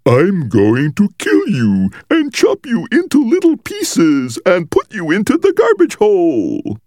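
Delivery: slow, very high, hammed-up monster; phony monster voice. Brother play-threatens to chop up his siblings, phony monster voice. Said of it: "In a phony monster voice".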